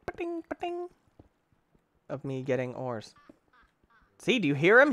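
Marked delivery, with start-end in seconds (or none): 0.1-0.9 high little "pa-ting" noises; imitated ore chime. Gamer imitating the sound of getting ores, high chiming. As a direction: imitate a small high ping. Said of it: "it's fun to hear the little".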